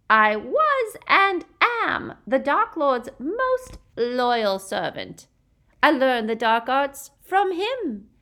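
high, loud, slow proud boast; a fanatical witch gloating. A narrator's reading. Bellatrix boasting.

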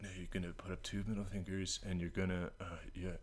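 very soft muttered rehearsal; mumbling to himself. Retold or imitated. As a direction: mumble it under your breath, imitating someone rehearsing. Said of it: "mumbling that speech to himself".

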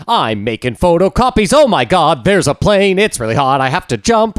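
high, loud hammed-up alarm; exaggerated stage distress, pitched high; mock panic. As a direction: act out a musical character's mounting panic. Mock acts a victim in the musical.

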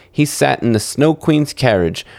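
ordinary delivery

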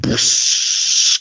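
hissing crash sound effect; imitated crash. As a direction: make a body crashing through glass with your mouth. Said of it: "crashing through".

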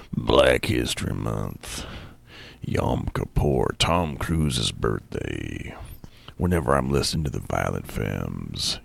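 deep, slow, growling biker dude; comic tells of his Harley accessories as a low, slow biker; biker character. Acted. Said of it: "I become the character".

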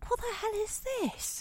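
high, appalled outburst of distaste; disgusted surprise. Acted. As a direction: recoil at the taste. Tasting disliked tea.

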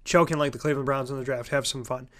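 fast, soft throwaway repeat; quiet aside. Real, not acted. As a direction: mutter the joke again under breath. Repeats his joke as a quick aside.

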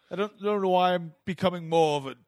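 badly faked English accent sliding downward; English put-on voice falling apart. Losing the Jizzy impression, slipping English accent. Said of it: "I can't do a good English accent".

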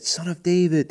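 high-pitched call at normal volume; imitated holler from a crowd; mock holler. A narrator's reading. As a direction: call out high without really shouting. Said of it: "they hollered".

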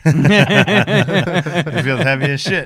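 slow drawn-out line through laughter; laughing. Real, not acted. Host cracking up at the lifter's story, slow laughing line. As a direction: laugh the words out slowly.

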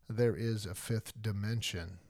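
low, soft mock narrator; soft spooky narration. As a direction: hush it like an eerie TV host. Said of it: "Welcome to the Twilight Zone".

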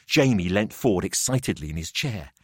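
ordinary delivery, nothing marked

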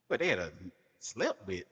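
ordinary talk, nothing marked

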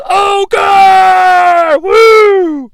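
high, loud party cheer; crowd yell. Retold or imitated. Frat crowd cheering the Ogre as he walks in, high and loud. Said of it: "someone in the movie yells".

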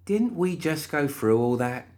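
slow, weary high voice; tired of this nonsense; exasperated. A narrator's reading. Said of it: "pinched the bridge of her nose".

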